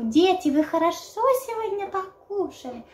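high sing-song teacher voice; kindergarten teacher. Retold or imitated. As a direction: talk like a kindergarten teacher to kids. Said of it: "как воспитательница в детском саду". (голосом воспитательницы детского сада)

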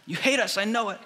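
put-on high, whining child's complaint; whiny kid. Kid at Disneyland whining to the parent, high and whiny. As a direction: pout it out like a sulking kid.

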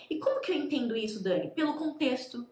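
ordinary delivery

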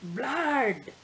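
understated storybook scream; mild scream. Acted. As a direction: gasp it out in shock. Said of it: "and screamed".

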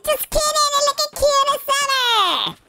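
high, squealed cooing; gushing. Pretend. Player takes back an insult to doggy, high gushing. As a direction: gush over a pet in a high voice. Gushes at doggy after teasing.

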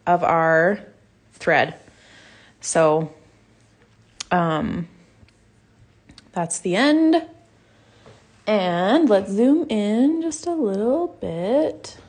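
talking with a numb mouth; words a bit slurred from numbness. Real, not acted. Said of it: "my words are a little bit funny. My mouth is still numb".

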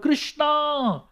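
high, drawn-out mock cry for help; storyteller imitating a terrified yell; retold frightened shout. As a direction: call the name high, without full volume. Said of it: "Balaram shouted out".

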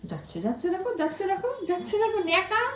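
high, sing-song rising coos. Real, not acted. Mother singing out to toddler, high baby-talk lilt.

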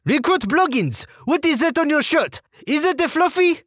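high-pitched mock French-Canadian woman sergeant. Sergeant inspecting recruit's shirt, French-Canadian accent. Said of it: "female French-Canadian sergeant with this accent".

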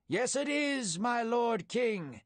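high, drawn-out call at modest volume; storyteller's restrained distant shout; shouted reply. A narrator's reading. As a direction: call it out slowly across a valley. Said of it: "David shouted".

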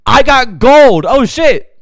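loud, high shout of glee; excited discovery. Real, not acted. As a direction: shout the lucky find. Thrilled at finding gold.

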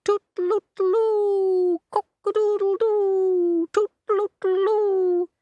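very high, slow tooting crow; piercing, held toots and crowing; cockerel trumpet. Acted. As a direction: trumpet it out like a rooster. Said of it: "play the trumpet as loud".

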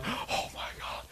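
breathless, slow whisper of awe; awestruck whisper. Retold or imitated. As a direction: whisper it, overwhelmed. Overwhelmed after run, acted.

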